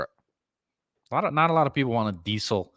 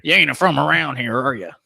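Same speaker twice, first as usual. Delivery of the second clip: louder, put-on New York accent; 5 semitones higher, 3 dB louder than usual. Mocking an out-of-towner in a bad New York accent. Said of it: "But in a New York accent".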